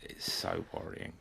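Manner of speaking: whispered, slow and breathy mock concern; a whispered, slow show of fake concern; hushed mock worry. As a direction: drop to a whisper as if truly worried. Mock worry after the joke.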